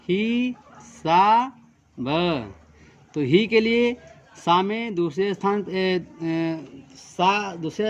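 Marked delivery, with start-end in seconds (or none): 0.1-2.5 हिसाब broken into ही, सा, ब with pauses between; dictation-style ही सा ब; split into syllables. Shown as a demonstration. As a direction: spell out हिसाब slowly, syllable by syllable. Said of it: "हिसाब".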